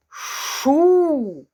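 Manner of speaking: Шу blended into one drawn-out syllable. Shown as a demonstration. Teacher showing children how the sounds blend into Шу. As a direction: join the sounds into Шу. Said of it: "Скільки звуків ви назвали?".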